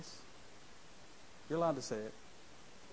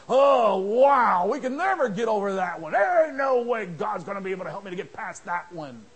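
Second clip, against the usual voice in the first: mock despair; 14 dB louder than usual. Voicing a despairing believer.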